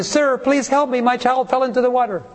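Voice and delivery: high, urgent acted begging; desperate plea. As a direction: plead for help as a panicked parent. Acted parent begging a stranger.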